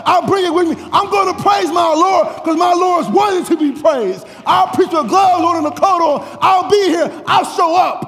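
high, loud pulpit declaration; full-force preaching. Acted. Preacher declaring readiness to serve to the congregation, full-force preaching.

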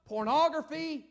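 loud hellfire preacher, pressing each line home; fiery preaching. Acted. Preacher thundering at the congregation about raising children, fiery preaching. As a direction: preach it hard to the pews.

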